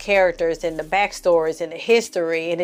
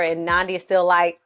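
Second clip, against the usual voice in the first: ordinary talk, nothing marked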